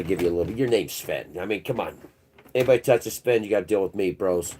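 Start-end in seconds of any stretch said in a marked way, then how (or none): none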